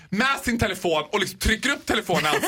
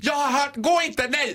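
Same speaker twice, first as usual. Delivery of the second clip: interfering friend; 8 semitones higher than usual. Acted. Friend stopping her pal leaving with Jocke, high protesting. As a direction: wail the warning like a meddling friend.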